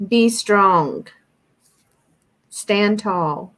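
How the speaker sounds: slow, deliberate commands with long pauses; mildly stern channeled message; spaced-out, firm words of encouragement. Retold or imitated. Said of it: "he's being very stern".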